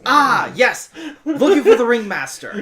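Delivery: high, loud and showy; loud in-character announcement. Acted. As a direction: call it out with gusto. Player voices her character announcing herself.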